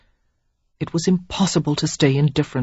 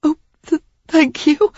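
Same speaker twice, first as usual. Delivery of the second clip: high, breaking, tearful; stumbling through sobs; tearful young woman; 12 semitones higher, 3 dB louder than usual. A narrator's reading. Sarah sobbing her confession to Derek.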